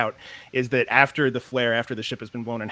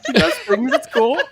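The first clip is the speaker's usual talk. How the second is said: laughing; 12 semitones higher, 7 dB louder than usual. Real, not acted. Laughing about Allie.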